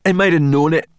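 high, loud angry snap; snapped. Acted. Sorrel to Ben about the spy, snapping. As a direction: bite the words off in anger. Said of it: "snapped Sorrel".

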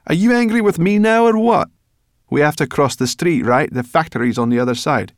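prickly creature voice; snappish brownie. Acted. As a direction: bite back at him. Sorrel snaps at Ben.

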